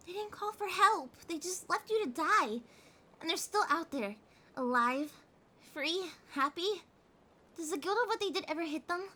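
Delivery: angry. Acted. Catgirl raging to her partner about the driver who killed him, angry. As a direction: rage at the driver who fled.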